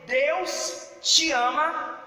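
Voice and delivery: high, slow, drawn-out declaration; preached proclamation. Retold or imitated. Preacher retells telling students the good news, proclaiming it high.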